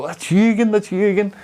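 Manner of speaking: high, delighted repeated greeting; cheery greeting. Retold or imitated. Shop man greeting the returning cyclist, delighted. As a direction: greet a regular with glee. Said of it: "the boy was all".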